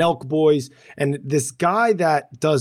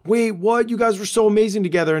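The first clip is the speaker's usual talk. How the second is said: higher and fast, mock disbelief; mock shocked fan; 9 semitones higher than usual. Fans hearing a couple split, shocked and fast. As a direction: play the stunned follower hearing of the divorce. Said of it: "And everybody's like".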